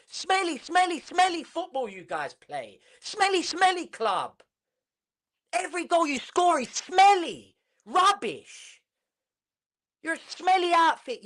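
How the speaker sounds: high, sing-song jeering; mocking taunt. Real, not acted. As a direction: tease the rival fans. Taunting Arsenal fans.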